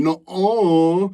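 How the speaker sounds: put-on high, loud childish "Nuh-uh"; dismissive nuh-uh. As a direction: toss it off like a sulky kid. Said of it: "basically going".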